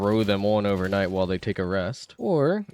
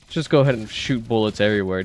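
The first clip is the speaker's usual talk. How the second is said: ordinary talk, nothing marked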